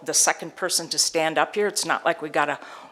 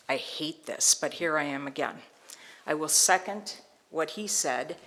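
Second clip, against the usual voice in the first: ordinary talk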